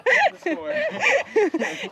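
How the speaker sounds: high laughing; high burst of laughter. Real, not acted. Laughing at a mishap.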